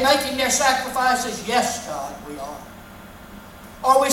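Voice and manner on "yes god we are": much lower voice answering God; smug worshippers. Acted. People answering God, lower and self-satisfied.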